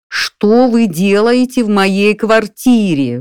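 outraged owner roaring at the burglar. A narrator's reading. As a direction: bellow the question down the line. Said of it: "взревел я".

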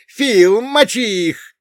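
very high, loud battle cry; full-throated yell. Acted. Dwarf calling Phil into the fight, full-throated yell. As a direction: scream for backup at full throat. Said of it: "Во всё горло завопил карлик".

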